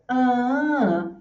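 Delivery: "ah" as a long, high, sustained note; stress melody. Shown as a demonstration. Teacher hums three syllables' pattern, stress melody. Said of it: "então você faz".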